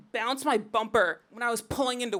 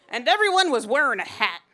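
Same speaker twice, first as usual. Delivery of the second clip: loud, emphatic announcer-like impression; hammed-up voice-over, loudly proclaimed; Rick Laubscher; 7 dB louder than usual. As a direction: boom it out as a narrator impression. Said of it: "that's my Rick voice".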